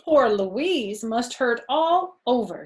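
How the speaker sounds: doctor's sympathy. Acted.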